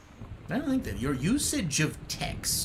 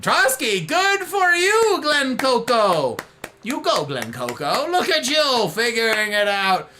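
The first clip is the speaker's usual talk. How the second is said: cheering; 5 semitones higher, 5 dB louder, slower than usual. Real, not acted. Cheering a chatter.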